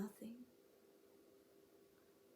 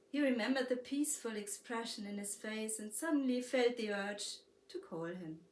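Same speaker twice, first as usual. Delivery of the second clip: plain reading voice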